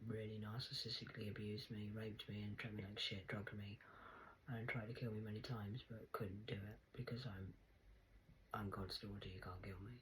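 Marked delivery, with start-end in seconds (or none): none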